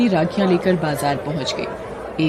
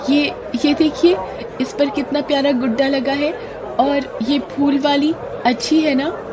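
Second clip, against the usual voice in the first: forced cheer; 7 semitones higher than usual. Acted. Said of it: "खुश दिखने की कोशिश".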